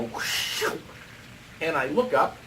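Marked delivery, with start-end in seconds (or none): 0.0-0.6 airy, voiceless whoosh; whooshing sound effect; imitated whoosh. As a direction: mimic a rush of air. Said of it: "I go".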